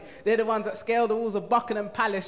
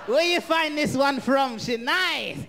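higher, louder leering dad greeting; dad's voice; 5 semitones higher, 3 dB louder, much slower than usual. Retold or imitated. Dad greeting his son's girlfriend, higher and loud. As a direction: shout it like a delighted old dad. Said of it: "He's like".

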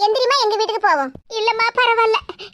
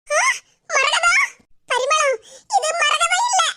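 startled call; 6 semitones higher, slower than usual. Acted. Calling out startled.

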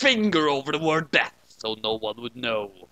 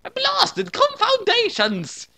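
very high, loud hammed-up British villain roar; roaring British accent; 5 dB louder, slower than usual. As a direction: bellow it like a cartoon British villain. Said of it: "he roared in a manner that was British".

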